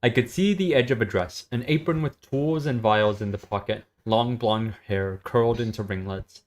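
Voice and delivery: steady story reading, level and even; story narration. Acted. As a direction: read the scene out to a friend. S0 reads a story aloud.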